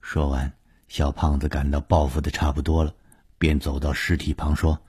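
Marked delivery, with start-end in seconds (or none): none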